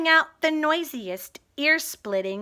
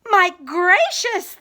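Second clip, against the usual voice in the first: louder, higher amazed cry; shouting; 5 semitones higher, 5 dB louder than usual. Acted. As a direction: exclaim in wonder. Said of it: "they shouted".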